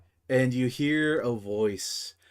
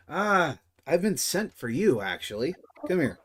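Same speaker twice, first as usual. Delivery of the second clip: NPC voice; 6 semitones higher, 4 dB louder than usual. Acted.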